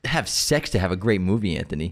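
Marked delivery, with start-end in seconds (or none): 1.6-1.9 low, reproachful name call; scolding. Real, not acted. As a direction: chide him, dropping the voice.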